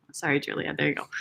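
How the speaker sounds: ordinary delivery, nothing marked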